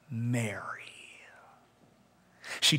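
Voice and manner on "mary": much lower and softer, tender; Jesus gently saying her name to weeping Mary; tender whisper of a name. A narrator's reading.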